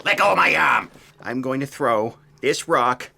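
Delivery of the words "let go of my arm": angry shout. Acted. Struggle over rock.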